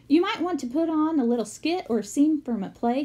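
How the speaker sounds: a hint of an upbeat teacher selling the idea; mild cheery teacher pitch. Teacher announcing talent show.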